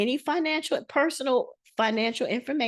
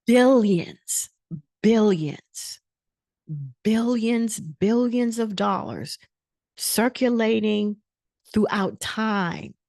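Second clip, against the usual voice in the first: preaching build; slower than usual. Acted.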